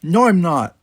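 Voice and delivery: Tanya snapping at Worf; shot back. Acted. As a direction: fire it straight back. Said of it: "she shot back".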